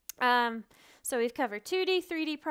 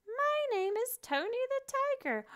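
much higher puppet voice; tiger puppet voice; 14 semitones higher than usual. Pretend. The tiger puppet answers the teacher in a high cartoon voice. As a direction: squeak it like a cute puppet tiger. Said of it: "what is your name?".

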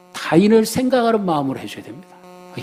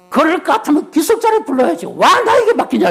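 yelling husband; 12 semitones higher than usual. Retold or imitated. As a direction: bellow back in a much higher voice. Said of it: "막 고래고래 감을 지르는 거예요".

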